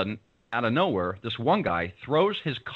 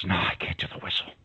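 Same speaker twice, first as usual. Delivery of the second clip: hushed whispered refusal; 5 semitones lower, 3 dB quieter than usual. Retold or imitated. Pete refusing his friend by the door, whispered. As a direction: whisper it so nobody hears.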